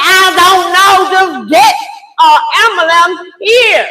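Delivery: high, loud retold outburst; loud, exasperated urgency; frantic demand. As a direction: re-enact yelling at the operator.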